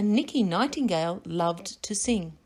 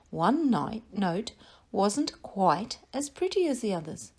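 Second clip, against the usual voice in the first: plain reading voice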